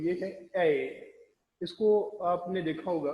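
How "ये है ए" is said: slow drawn-out ए sound; demo of ए. Shown as a demonstration. Teacher demonstrating ए to students, slow and drawn out. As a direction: model the ए sound slowly. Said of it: "मैं आपको बता देता हूँ".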